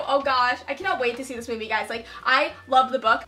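ordinary delivery

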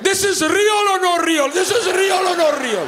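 high, urgent disbelief; incredulous dad. Retold or imitated. Dad questions his kids about the video, incredulous. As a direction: demand an answer in a high mock-dad voice. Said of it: "this is as close of an impression as I can do".

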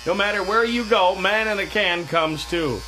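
ordinary delivery

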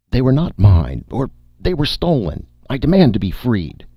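high, loud demand to be freed; desperate shout. A narrator's reading. Needlesmith yelling at the spokesman to free him, high and loud. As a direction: yell it, panicking.

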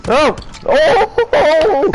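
high, loud startled yell; excited shout. Real, not acted. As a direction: burst out in a shriek. Reacting to a game moment.

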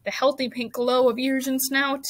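usual speaking voice

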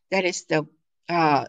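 ordinary talk

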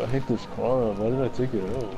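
ordinary delivery

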